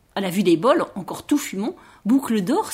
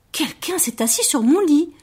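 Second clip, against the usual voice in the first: Mama Bear's gentle voice; 6 semitones higher than usual. A narrator's reading. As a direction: keep it gentle, in a higher pitch. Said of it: "dit maman ours d'une voix toujours douce". (voix douce de maman ours, plus aiguë)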